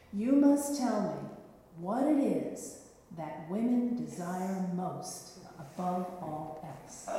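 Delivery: slow, weighty knight's riddle. Acted. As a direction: lay out the riddle slowly, with menace. Knight poses his riddle.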